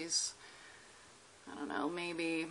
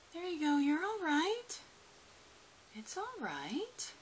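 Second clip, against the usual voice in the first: soothing; 10 semitones higher than usual. Real, not acted. Calming the lizard.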